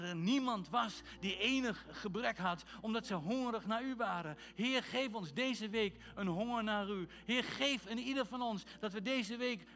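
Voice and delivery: loud, urgent praying; fervent prayer. Real, not acted. Preacher praying over his congregation, fervent. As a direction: plead with God for the church.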